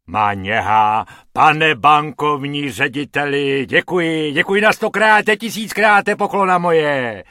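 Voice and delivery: loud, elated street performer; exuberant thanks. A narrator's reading. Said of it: "nýbrž rozjařeně".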